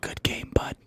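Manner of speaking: whispered fake-polite taunt; mock whisper. Teammate whispering a smug taunt at the handshake line, mock whisper. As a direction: breathe it like a sly secret.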